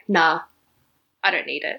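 low, quiet brush-off; retold dismissal. Imitating him refusing help, low dismissive brush-off. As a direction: shrug it off, voice dropped low. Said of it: "And he's like".

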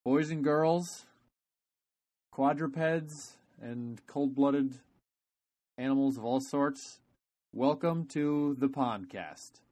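big circus ringmaster announcement. Pretend. Podcast opening announcement.

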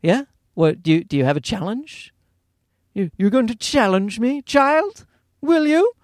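a stern British nanny's challenge, rising high at the end; lightly British strict teacher. Retold or imitated. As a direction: dare the child, like a strict nanny. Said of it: "British nanny teacher".

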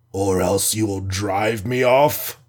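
the alien scoffs at the hero's warning; scornful. A narrator's reading. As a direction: throw the threat back with contempt. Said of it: "with a scornful laugh".

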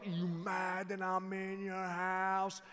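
drawn-out challenge in an odd neighbor's voice; creepy stranger. Retold or imitated. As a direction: imitate a sweaty weird dude, dragging out the words. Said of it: "here's this dude all like".

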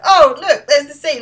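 high, loud delighted mock cry; excited exclaim. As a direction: burst out like you've spotted the sea. [name] spots the sea excitedly.

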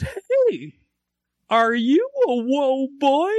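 very high, slow, sing-song clown voice. Acted. Clown teasing the grown-up kid, high and slow. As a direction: tease the man in a silly high clown voice. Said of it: "he goes".

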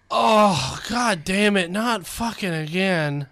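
loud, drawn-out fed-up groan of words; exasperated God. Acted. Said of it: "he went like this".

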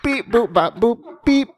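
high beeping robot voice; toy robot voice. Pretend. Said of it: "I am a robot".

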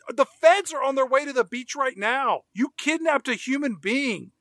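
high-pitched, incredulous scolding; exasperated outburst. Acted. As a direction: scold them urgently, pitch jumping up on each phrase. Imagined outburst at film character.

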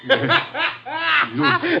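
high, lighter cackle; high giggling cackle; gloating laugh. Acted. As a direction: laugh mockingly at someone helpless. Gunman gloats over victim.

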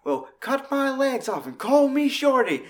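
put-on high holler, hammed-up amazement; Mannix impression. Fan quoting a man spotting the General, high astonished holler. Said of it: "And he was like".

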